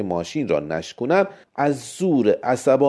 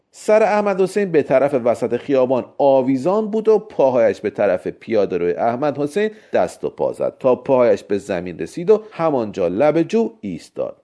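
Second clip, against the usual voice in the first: ordinary delivery, nothing marked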